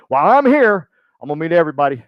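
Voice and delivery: loud, high, hammed-up enthusiasm; playful over-eager impression of a colleague; imitated eager networker. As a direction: announce it big, mimicking a keen mingler. Imitating Daniel's eager philosophy.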